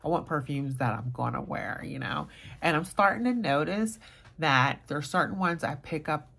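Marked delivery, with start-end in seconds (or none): none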